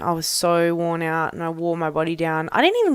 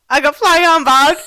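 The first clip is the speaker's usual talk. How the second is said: high, loud confession of defeat; sheepish loud admission; 8 semitones higher, 9 dB louder than usual. Retold or imitated. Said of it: "I was like".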